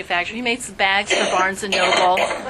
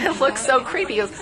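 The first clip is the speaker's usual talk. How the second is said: usual speaking voice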